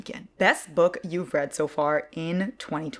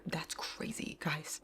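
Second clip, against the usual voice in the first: whisper; 12 dB quieter, much slower than usual. Real, not acted. Aside to viewers.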